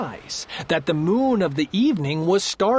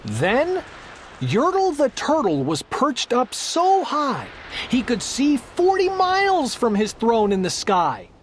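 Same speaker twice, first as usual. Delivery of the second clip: plain reading voice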